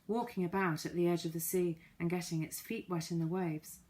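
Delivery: usual speaking voice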